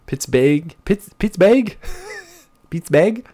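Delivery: "Pittsburgh" with a strong rolled hard R; Pittsburgh spoken with an exaggerated hard R. Shown as a demonstration. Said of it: "with a hard R".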